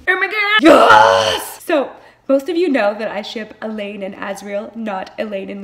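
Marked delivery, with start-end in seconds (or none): 0.1-1.6 loud squeal of joy; excited shout. Real, not acted. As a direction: shout it out in delight. Excited reaction to the book.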